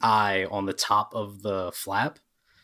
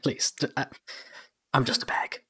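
flattered talking bag; 5 semitones higher, 3 dB quieter than usual. Acted. Game master plays the flattered talking bag.

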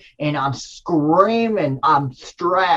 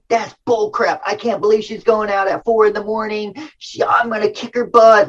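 frustrated retort; 7 semitones higher than usual. Retold or imitated. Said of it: "And I said".

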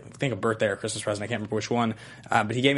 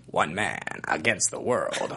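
eighties trailer voice; 7 semitones lower, 5 dB quieter, slower than usual. Shown as a demonstration. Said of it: "the eighties trailer voice would be".

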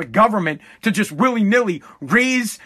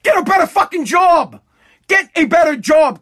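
louder, higher barked order; shouted command; 7 semitones higher, 4 dB louder than usual. Real, not acted. Ranting at minimum-wage earners, shouting the order. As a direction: shout it at the listener like a drill sergeant.

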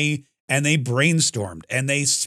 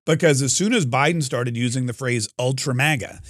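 ordinary talk, nothing marked

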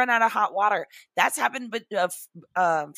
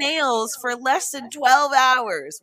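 mock scolding shout; 5 semitones higher, 7 dB louder, slower than usual. Scolding friend over child labour.

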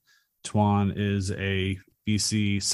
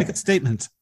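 higher-pitched, lightly imitated designer; interior designer; 6 semitones higher, faster than usual. Designer giving camouflage advice, lightly imitated high voice. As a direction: give it an imitated designer's flourish. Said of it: "doing with the interior designer".

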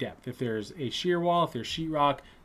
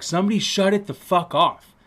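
louder, higher retold outburst; mock loud mad; 6 semitones higher, 5 dB louder than usual. Classmate fed up with the alarm noise, acted loud anger. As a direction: imitate a classmate losing his temper. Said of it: "like, loud mad".

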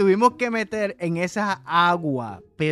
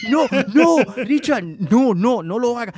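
loud, higher, laughing pleas; panicked pleading; 4 semitones higher, 7 dB louder than usual. Retold or imitated. Friend climbs federal fence, loud laughing plea. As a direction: beg him to get down, half laughing.

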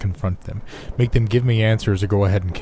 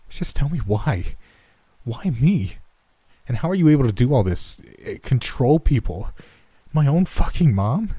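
desperate, rising pleading; 5 semitones higher than usual. Acted. Protagonist pleads for answers.

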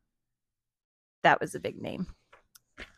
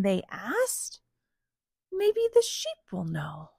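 lively, higher-pitched animal dialogue; story read-aloud; 5 semitones higher, 3 dB louder than usual. A narrator's reading. Parent reads cows and rooster scene, animated storytelling. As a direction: act out the farm animals for small listeners. Reads picture book to children.

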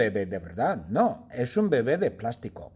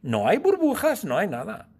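high exclaim; 9 semitones higher, 4 dB louder than usual. Acted. Niega alucinación.

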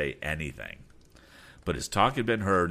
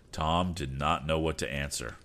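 usual speaking voice

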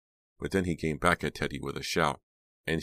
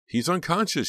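very high, loud wail; 6 dB louder than usual. A narrator's reading. Dina wailing over her brother, loud wail. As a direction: wail in panic. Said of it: "she wailed".

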